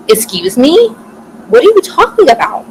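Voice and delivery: outraged disbelief, rising high; indignant outrage. Retold or imitated. Hearing about the bet, indignant and angry. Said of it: "am so mad".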